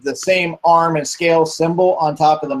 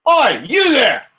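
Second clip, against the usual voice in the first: gruff villager; 11 semitones higher than usual. Acted. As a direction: call out to an intruder, then soften. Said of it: "he's like".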